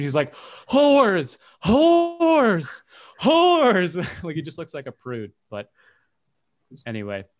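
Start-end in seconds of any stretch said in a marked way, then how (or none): 0.7-4.0 hammed-up stage horror, loud, high and drawn out; mock terrified cry. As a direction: cry it out like an overacting Macbeth player. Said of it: "terrified 'cause someone's been murdered".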